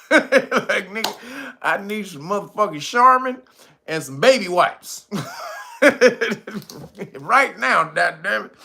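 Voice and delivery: mock desperate Biden.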